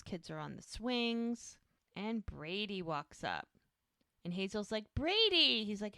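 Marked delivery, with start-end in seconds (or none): 4.9-5.6 high, loud, delighted shout of a name; excited child's greeting. Retold or imitated. Hazel spots Brady at the park, excited call. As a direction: imitate a kid calling out happily.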